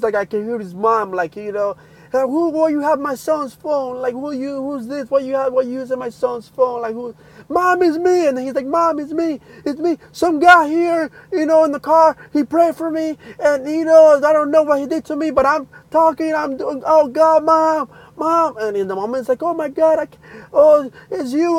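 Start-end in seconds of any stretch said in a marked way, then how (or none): 2.1-7.1 higher-pitched, anxious questioning; retold suspicious mom; alarmed mother. 7.5-8.3 high, loud, urgent plea; retold emotional phone call; overwhelmed son. Retold son calling mother, overwhelmed. 8.7-18.6 high, loud, breathless-with-news rush; retold emotional phone call; overwhelmed son. 19.4-21.6 high, loud, overcome cries; imitated tearful disbelief; crying mother. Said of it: "crying on the phone".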